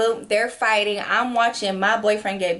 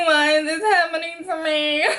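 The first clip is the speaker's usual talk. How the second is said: high, tearful whine broken by laughs; half-crying, half-laughing at yourself; laughing mock cry; 6 semitones higher than usual. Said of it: "I'm still downstairs crying".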